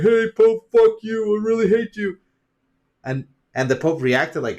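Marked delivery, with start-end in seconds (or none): 0.0-2.2 high, loud pretend heckle; mock angry yelling. Imagines confronting the Pope, mock angry yell. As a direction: call it out loudly in mock hatred.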